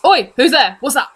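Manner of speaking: loud, challenging guard's shout; guards calling out. Acted. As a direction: call across the grounds to intruders. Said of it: "guards calling to each other".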